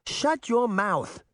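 high, drawn-out outburst; indignant cry. A narrator's reading. Fanny cuts off the mirror, crying out. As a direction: cry it out high, with slow emphasis. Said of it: "Fanny cried".

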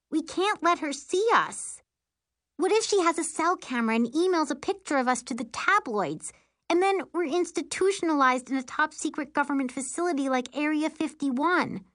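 high-pitched, worried rant; panicky sister. A narrator's reading. Sister afraid of being seen, panicking.

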